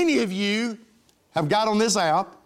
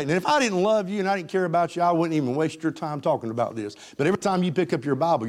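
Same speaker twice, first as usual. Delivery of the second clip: usual speaking voice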